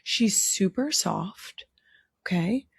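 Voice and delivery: slow soft whisper; slow ASMR whisper about the soft bunny. Real, not acted. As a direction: hush it as if not to startle her. Whispering over the bunny, ASMR-style.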